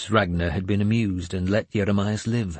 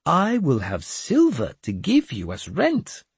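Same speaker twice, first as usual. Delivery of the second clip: sly; 7 semitones higher than usual. Acted. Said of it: "he added slyly".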